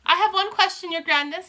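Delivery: high, fawning witch voice; a witch asks the Grand High Witch; witch in audience. Acted. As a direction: ask the queen timidly. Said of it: "said a voice in the audience".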